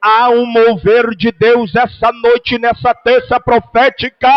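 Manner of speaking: loud, ringing sermon over music; full-force preaching. Acted. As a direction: preach it out to the whole church.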